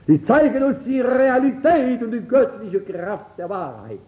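high-pitched, forceful preaching; impassioned pulpit declaration; fervent proclamation. Real, not acted. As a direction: proclaim it to the whole congregation.

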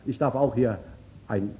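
ordinary talk

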